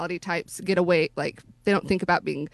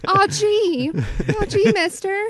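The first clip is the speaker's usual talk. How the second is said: put-on old-timey gee whiz voice; 11 semitones higher than usual. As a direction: play a wide-eyed 1920s kid.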